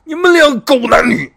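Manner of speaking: slow, high enraged yell at the adulterers; husband catching his wife, roaring through the door; furious shout. Retold or imitated. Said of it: "在那喊一声".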